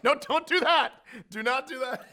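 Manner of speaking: laughing protest. Retold or imitated. Laughing protest at president's joke.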